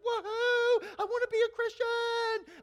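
high loud whooping crowd; crowd cheer. Retold or imitated. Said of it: "People are like".

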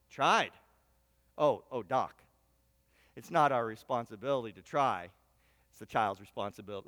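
low, smug excuse to the doctor; clueless parent. Acted. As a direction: drop the voice way down, shrugging off blame.

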